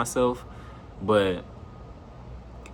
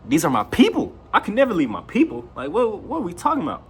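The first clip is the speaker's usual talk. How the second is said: higher and louder, fired up; heated insistence; 10 semitones higher, 4 dB louder than usual. Real, not acted. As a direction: pitch up and push it out. Defending his strange friends.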